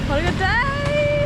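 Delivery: high, slow happy exclamation; excited drawn-out cheer. Real, not acted. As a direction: stretch it out with delight.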